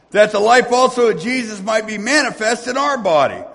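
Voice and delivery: loud, high, cadenced preaching of the verses; preaching scripture. Acted. Preacher proclaiming Paul's letter to his congregation, full force. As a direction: proclaim it to the whole church.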